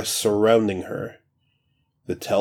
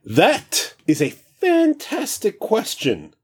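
excited; 13 semitones higher than usual. Acted. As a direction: relish the question. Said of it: "says excitedly".

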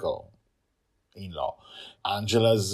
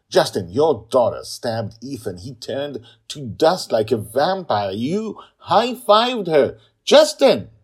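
loud, higher furious yelling; furious accusation; 8 semitones higher, 13 dB louder than usual. Acted. Sister accusing brother, rising furious yell. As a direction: build to a screaming accusation.

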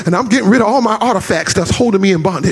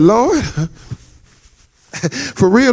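laughing plea; slower than usual. Retold or imitated. Retelling his plea to God.

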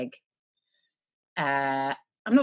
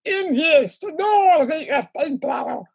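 high, hammed-up attempt at Scottish; bad Scottish accent; 8 semitones higher, 4 dB louder than usual. Playing Jamie on co-host's prompt, badly faked Scottish accent. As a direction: have a poor stab at a Scottish accent. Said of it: "In his Scottish accent".

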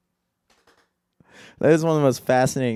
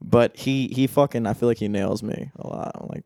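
ordinary talk, nothing marked

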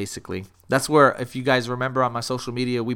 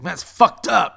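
louder, higher imitation of an upset friend; worked up; 5 semitones higher, 6 dB louder than usual. Retelling a friend fuming at the bouncer, worked up. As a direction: punch it out, imitating his indignation. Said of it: "he's getting all worked up".

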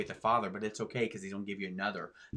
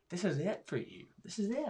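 rising higher on each this is it; Jesus quoted; 8 semitones higher than usual. Retold or imitated. Host voicing Jesus warning the rich, pitched higher. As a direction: climb up in pitch, insistent. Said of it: "So he's going".

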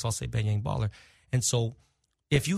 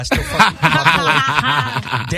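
laughing; 12 semitones higher, 10 dB louder, much slower than usual. Real, not acted. Laughing while giving a shout-out.

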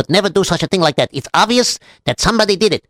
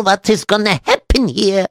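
usual speaking voice

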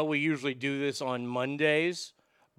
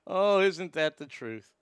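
ordinary delivery

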